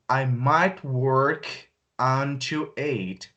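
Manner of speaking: very slow, split phrase; slow teaching voice; slow model sentence. Shown as a demonstration. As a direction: say it in two slow halves. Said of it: "slow model take".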